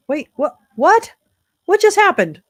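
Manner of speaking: high loud startled cry; shocked. Real, not acted. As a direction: yelp in sudden alarm. Stream sound suddenly lost.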